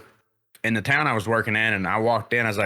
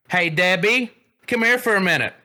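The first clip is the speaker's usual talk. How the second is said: calling out; 6 semitones higher than usual. Retold or imitated. Store clerk calling coworker Debbie, raised voice. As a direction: call Debbie over from the deli. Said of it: "she was like".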